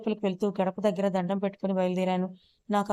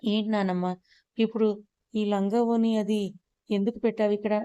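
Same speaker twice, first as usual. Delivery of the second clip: ordinary delivery, nothing marked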